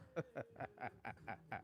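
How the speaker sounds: soft, real chuckling laugh. Real, not acted. Amused by her own Jersey accent, soft laughter. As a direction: crack up quietly at your own act.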